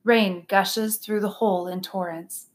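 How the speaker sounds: ordinary delivery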